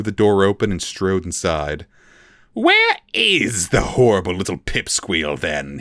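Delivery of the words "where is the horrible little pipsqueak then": impatient demand; a mild angry call for the prisoner. A narrator's reading. Academic demands the prisoner, a mild impatient demand. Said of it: "he demanded".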